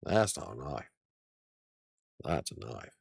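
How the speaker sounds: low mock Australian accent; Crocodile Dundee. Fan quoting a favourite movie, low Australian accent. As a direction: quote the film in a deep, put-on Aussie voice. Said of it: "how many times I watched Crocodile Dundee".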